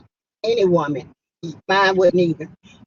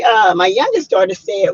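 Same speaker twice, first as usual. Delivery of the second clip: normal talking voice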